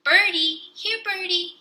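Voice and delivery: put-on very high, sing-song toddler call to the birds. A narrator's reading. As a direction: call the pigeons like a small child. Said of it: "he called".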